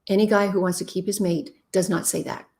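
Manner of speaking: ordinary delivery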